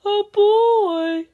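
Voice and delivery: loud, high yell of alarm; big storybook shout of shock. A narrator's reading. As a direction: bellow it as a startled bear. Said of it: "yelled the bear".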